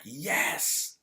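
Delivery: high, drawn-out yes; retold mini celebration; delighted yes. Man cheering mom's parking offer, delighted.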